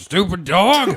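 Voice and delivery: put-on cranky old farmer snapping; Eustace voice. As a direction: bark it like a grumpy old farmer. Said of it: "Eustace from Courage the Cowardly Dog".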